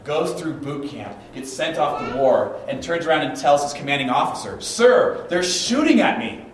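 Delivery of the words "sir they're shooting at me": louder, higher protesting cry; hammed-up recruit reporting in shock; alarmed young soldier. Soldier to his commanding officer under fire, alarmed.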